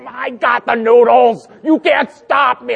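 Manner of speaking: put-on high, loud, slow toddler taunt; defiant toddler. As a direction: gloat like a stubborn little kid. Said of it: "She's like".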